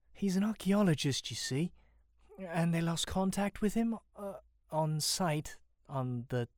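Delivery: timid and high, slowing as it goes; nervous introduction. A narrator's reading. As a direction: explain yourself nervously, voice lifted. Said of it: "gulps nervously before responding".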